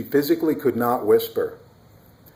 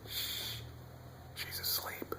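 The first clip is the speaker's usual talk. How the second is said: hushed whisper, fading softer; 22 dB quieter than usual. Acted. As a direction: whisper so the baby stays asleep. Tiptoeing away from sleeping baby.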